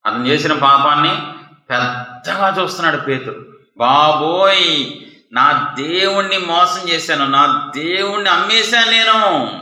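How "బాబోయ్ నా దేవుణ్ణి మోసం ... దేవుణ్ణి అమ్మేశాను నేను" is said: slow, rising, stretched-out wail; heartbroken lament, acted. Peter grieving that he betrayed his God, heartbroken acted wail. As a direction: cry it out as if your heart is breaking. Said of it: "గుండె పగిలేలాగా ఎందుకు ఏడుస్తున్నాడు".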